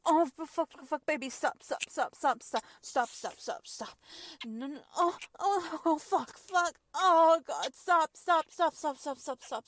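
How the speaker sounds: loud, high, frantic repeated cries. Acted. Woman at climax, frantic loud cries.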